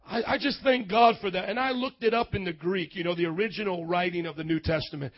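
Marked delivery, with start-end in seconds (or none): none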